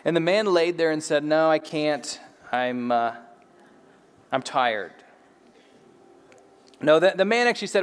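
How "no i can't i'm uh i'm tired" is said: low, halting excuse with pauses; mock weary refusal. Paralyzed man turns down the order to get up, mock weary. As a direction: feebly beg off, voice sinking.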